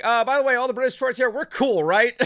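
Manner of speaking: hammed-up nervous Muppet reassuring the crowd; Fozzie impression. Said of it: "Fozzie's like".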